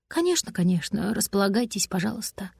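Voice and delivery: soft, courteous invitation, fading at the end; polite. A narrator's reading. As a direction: invite a guest in gently and quietly. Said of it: "тоже был хорошо воспитан". (тихо и вежливо)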